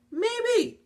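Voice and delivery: very high squeaky put-on voice; teasing mock suspicion. Reviewer echoing the reader's suspicion of the girlfriend, teasing squeal.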